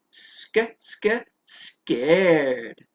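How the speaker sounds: sk, sk, scared with the cluster repeated; sounding out scared. Shown as a demonstration. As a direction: split scared into its first sounds. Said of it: "Can you say scared?".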